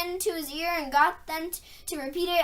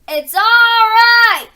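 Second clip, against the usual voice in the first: loud, higher, slow and sung out; joyous shout; 6 semitones higher, 14 dB louder, much slower than usual. A narrator's reading. Aslan reassuring the worried girls, joyous sung-out shout. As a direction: call it out with great joy. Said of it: "shouted Aslan joyously".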